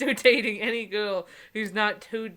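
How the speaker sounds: ordinary talk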